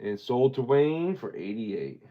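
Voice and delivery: drawn-out auctioneer call, slow on the last lines. Acted. Closing the coin auction to Wayne, slow auctioneer call.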